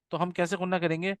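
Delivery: ordinary talk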